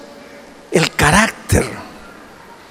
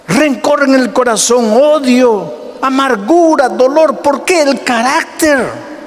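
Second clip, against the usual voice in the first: higher, rising sermon voice building to a peak; preaching at full force; 10 semitones higher than usual. Acted. Pastor preaching hard to his church about temper. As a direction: preach it out to the congregation.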